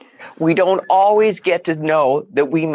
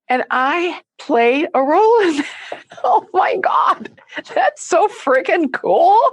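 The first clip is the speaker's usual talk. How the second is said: high, teary excited cry; laugh-crying with joy, much higher; overjoyed; 10 semitones higher than usual. Real, not acted. Overwhelmed by moving praise.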